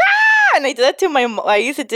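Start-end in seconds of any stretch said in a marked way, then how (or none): 0.1-0.6 mock startled scream. Coworker's scream.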